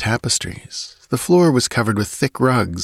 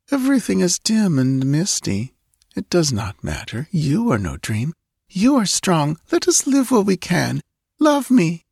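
put-on sleepy muttering girl; 9 semitones higher than usual. A narrator's reading. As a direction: mumble it half-asleep. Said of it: "she murmured".

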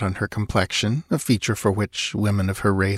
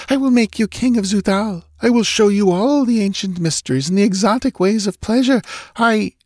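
high, pleading seduction; lightly voiced sultry woman; seductive temptress; 13 semitones higher, 4 dB louder than usual. Thalos seducing Conan.

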